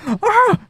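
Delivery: very high, contained scream; imitated far-off yell; distant mock scream. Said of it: "you just hear him like".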